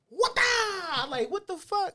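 very high-pitched mock outburst; exaggerated imitation. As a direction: imitate a wild reaction way up high. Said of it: "It ain't like he was like".

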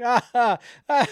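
high, loud "ah, ah" sobbing laugh; imitated laugh-cry. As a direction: mimic crying through laughter. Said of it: "I'm doing the cry".

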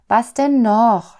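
slow, high, loud complaint; impatient groan. A narrator's reading. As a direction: groan it out, drawing the words long. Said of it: "stöhnte sie".

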